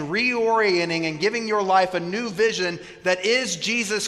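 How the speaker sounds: preaching at full force. Real, not acted. Pastor exhorting the congregation, fervent preaching. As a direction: build the congregation toward the point.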